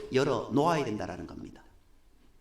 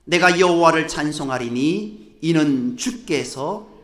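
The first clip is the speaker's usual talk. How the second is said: scripture reading; 2 semitones lower, 4 dB louder than usual. Acted.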